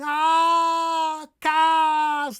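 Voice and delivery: high, loud, stretched aa in kaas; exaggerated long Dutch kaas; wide-open vowel. Shown as a demonstration. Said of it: "open my mouth as wide as I can".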